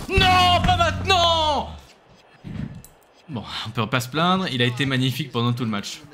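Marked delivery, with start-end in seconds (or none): 0.1-1.7 high, slow panicked cry; panicked shout. Real, not acted. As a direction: shriek in alarm. Panics at opponent's move.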